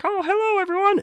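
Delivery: puppeteer voice. Retold or imitated. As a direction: talk like a hand is up a puppet. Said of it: "the dude's voice is like a puppeteer".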